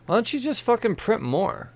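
real exasperation; exasperated. Real, not acted. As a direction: vent at the publishers. Exasperated rant at publishers.

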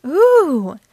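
high, loud excited "ooh"; thrilled child's exclamation; loud, soaring ooh of delight; excited gasp. A narrator's reading. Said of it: "gasped Peppa excitedly".

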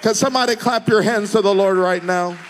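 loud, high, rhythmic preaching with shouted peaks; preaching at full force. Real, not acted. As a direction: build the crowd up to a roar.

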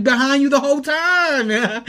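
very high, loud indignant cry; retold protest. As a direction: mimic a friend protesting, voice shooting up. Friend protests in retold quarrel.

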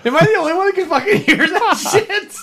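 high, fast, incredulous through laughter; laughing disbelief. Real, not acted. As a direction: burst out laughing while demanding witnesses. Incredulous at friend's noisy breathing.

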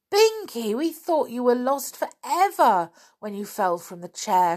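high, loud opening, then easing; joyful cry. Acted. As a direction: cry out the name in happy relief. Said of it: "cried Molly".

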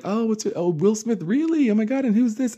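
squealing high, excited new acquaintance; high squealy fan voice; gushing stranger. Retold or imitated. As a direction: mimic a starstruck stranger far up in pitch. New people gushing at meeting.

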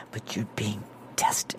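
slow hushed whisper, mock grave; mock solemn whisper. Podcaster whispering a parody of the line, slow and hushed. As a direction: breathe it slowly like a stern secret. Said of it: "You even had the face down right there".

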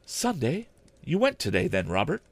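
high, questioning wife's voice; surprised wife. Acted. Wife asking her husband about his visit, high and surprised. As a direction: lift up in gentle surprise.

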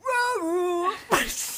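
high loud zombie bark. Retold or imitated. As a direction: yelp it like a toy zombie. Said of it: "it'd be like".

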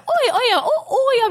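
oh, yeah squeaked very high in delight; sudden high-pitched excited squeal; excited yelp. Real, not acted. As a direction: pop up high with delighted laughter. Excited realisation with laughter.

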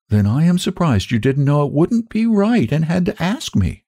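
put-on high-pitched, earnest child voice; sweet little girl. A narrator's reading. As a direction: voice a small girl, high and concerned.

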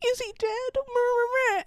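high, slow, wailing mock sob; mock crying. Little John and bunny crying over Robin, hammed-up sob. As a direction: whimper it like a crying child. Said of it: "they're, like, crying".

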